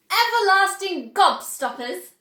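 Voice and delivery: high, loud proud exclamation; triumphant inventor's cry. Acted. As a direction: show off the invention. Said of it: "cried Mister Wonka proudly".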